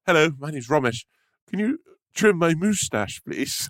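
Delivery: put-on prim voice, stretching "moustache"; mocking impression. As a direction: mimic a friend as absurdly posh. Mock impression of Romesh's posh speech.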